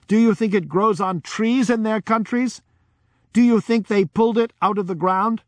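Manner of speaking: high, forceful rhetorical questions; dramatic village woman. Retold or imitated. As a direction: challenge the crowd with passion. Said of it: "Her dramatic talent was amazing".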